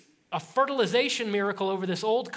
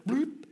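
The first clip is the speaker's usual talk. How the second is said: higher splashy bloop; bloop sound effect; 5 semitones higher than usual. Shown as a demonstration. Preacher adds the splash sound, a high bloop. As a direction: make the splash noise. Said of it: "I'm adding sound effects here".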